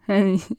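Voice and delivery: short fly chuckle; brief amused little laugh. Acted. Fly laughing at what the dog said, short chuckle. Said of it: "Die Fliege lachte".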